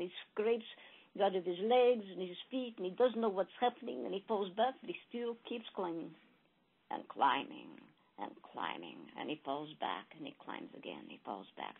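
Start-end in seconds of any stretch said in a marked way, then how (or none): 4.8-11.8 climbing blind, fading to an exhausted hush; fading exhaustion. A narrator's reading. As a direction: let the voice sink as strength fails. Exhausted mouse keeps climbing.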